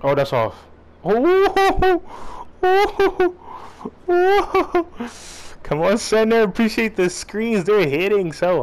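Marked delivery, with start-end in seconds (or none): 1.0-5.6 high laugh. Real, not acted. Laughs at a play.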